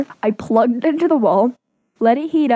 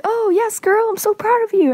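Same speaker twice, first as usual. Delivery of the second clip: high-pitched excited girlfriend impression; gushing friend; 11 semitones higher than usual. Friend sees the hickey at the sleepover, gushing in a high voice. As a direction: imitate a proud friend squealing encouragement, much higher.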